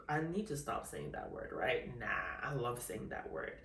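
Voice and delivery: normal talking voice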